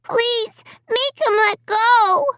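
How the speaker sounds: put-on high child's voice, slow pleading wail. A narrator's reading. Little girl begging her mother for help, high slow pleading wail. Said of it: "Alicia wailed".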